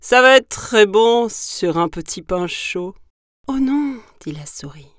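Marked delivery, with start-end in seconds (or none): 0.0-2.9 loud, greedy monster voice; growled relish at a coming meal; growling gruffalo. Acted. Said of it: "grogna le gruffalo". 3.5-4.0 high, loud protest; high-voiced small creature refusing; protesting little mouse. Mouse objects to being eaten.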